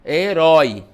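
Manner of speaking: loud, stretched open ÓI in "herói"; open sound. Shown as a demonstration. Teacher demonstrating an open diphthong, loud open ÓI in "herói". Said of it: "ele vai abrir o som".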